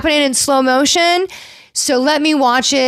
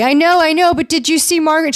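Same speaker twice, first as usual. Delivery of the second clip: higher, hurried imitation of a diva; mock self-absorbed; 6 semitones higher than usual. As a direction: cut in, imitating someone absorbed in herself. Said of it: "as narcissistic as she can be".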